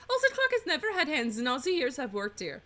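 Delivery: lilting French maid voice; chatty aside in a French accent. Acted. Housemaid explaining the clock without hands, French accent. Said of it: "doing a French accent".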